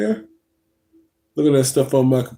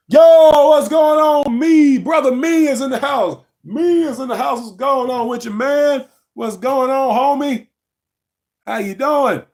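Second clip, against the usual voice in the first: hyped greeting; 8 semitones higher than usual. Real, not acted. Greeting viewer Me joining the live, hyped. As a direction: shout out a buddy joining the stream.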